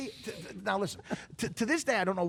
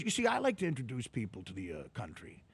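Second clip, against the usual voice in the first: imitating Lorne; 9 semitones lower than usual. Artie imitating Lorne's explanation.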